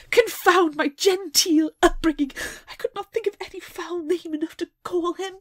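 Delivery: high, loud exasperated outburst; indignant heroine. Acted. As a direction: exclaim like a frustrated gentlewoman. Reading quote in character.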